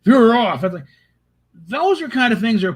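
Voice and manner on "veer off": louder repeated order. Retold or imitated. Kirk repeating his order to veer off, louder. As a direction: repeat the command, much louder. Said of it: "with him saying".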